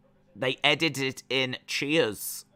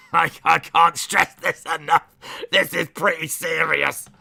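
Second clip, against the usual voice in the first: loud, higher voice breaking up with laughter; laughing protest; 4 semitones higher, 8 dB louder than usual. Real, not acted. Streamer warning the chat about timeouts, laughing through it. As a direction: insist through real laughter. Laughs while insisting it is serious.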